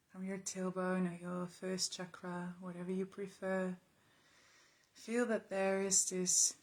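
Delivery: quiet, even meditation voice with long pauses; calm, unhurried, spacious delivery; guided meditation. Real, not acted. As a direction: guide them softly through noticing the body.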